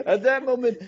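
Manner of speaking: high, loud, laughing voice. Real, not acted. Storyteller recalling a charged moment, laughing as he speaks. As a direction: talk through a real laugh. Talks on through his laugh.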